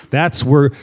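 usual speaking voice

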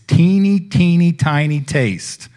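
put-on teeny, teeny said high and stretched, tiny taste slowed; drawn-out teeny. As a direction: lift and stretch each teeny to stress its smallness. Stressing how small the taste was.

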